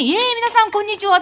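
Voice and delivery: high, loud, bouncy emcee opener; hyped MC greeting. Retold or imitated. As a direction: fire up the crowd like a host. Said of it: "とか言って、突然やり始めて". (ハイテンションな司会の挨拶)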